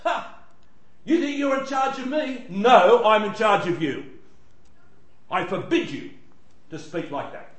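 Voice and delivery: high, commanding rebuke; rebuking Jesus. Acted. Jesus rebuking the demon, high and commanding. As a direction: put the demon firmly in its place. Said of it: "he says something like this".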